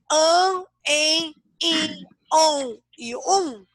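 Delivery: "an, en, in, on, um" spaced out and held; teacher drilling the sounds with pauses between; reciting nasal syllables. Shown as a demonstration. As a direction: chant each nasal vowel slowly, one by one. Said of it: "aprenderam a sílaba do anjo".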